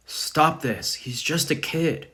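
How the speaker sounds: high, loud protest; pleading outcry. A narrator's reading. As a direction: cry out to spare the boy. Slave pleads for boy's life.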